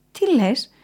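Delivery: high, amused question; smiling reply. A narrator's reading. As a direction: ask with an audible smile. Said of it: "απάντησε ο ζαχαροπλάστης χαμογελώντας".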